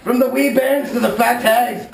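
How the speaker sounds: ordinary talk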